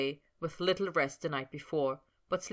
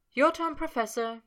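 Sabrina's line; 10 semitones higher, 5 dB louder than usual. A narrator's reading. Sabrina voiced in read story.

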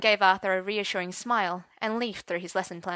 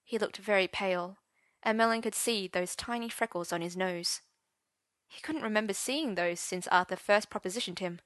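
usual speaking voice

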